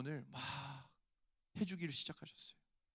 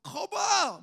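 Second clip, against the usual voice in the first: high, loud gloating yell; triumphant inner shout; 14 semitones higher, 11 dB louder, much slower than usual. Retold or imitated. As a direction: shout it like a voice that was proven right. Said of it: "이런 마음이 내 속에서 막". (높고 크게 외치는 "거봐!")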